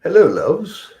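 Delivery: high, lilting mock British greeting; British accent. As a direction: put on a playful British accent, pitched well up. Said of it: "With a British accent".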